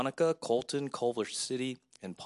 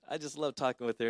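ordinary talk, nothing marked

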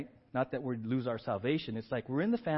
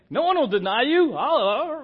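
loud, high, cocksure vow; bold boast; 10 semitones higher, 11 dB louder, slower than usual. Acted. Peter swearing loyalty to Jesus, loud brash boast. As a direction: shout it like a brash disciple.

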